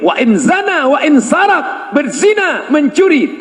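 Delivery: high, lilting chanted repeat; sing-song emphasis. Acted. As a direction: lift it up playfully.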